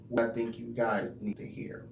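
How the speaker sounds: hushed confiding whisper. Acted. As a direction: lean in and whisper it. Confiding secret to viewers.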